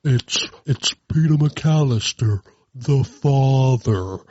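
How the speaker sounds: slow, dragged fake grown-up voice; slowed-down Talk Boy voice. Host imitating Kevin's Talk Boy, slow dragged voice. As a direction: stretch each word like a slowed tape. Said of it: "that slowed down voice that Kevin McAllister does".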